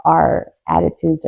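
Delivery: ordinary talk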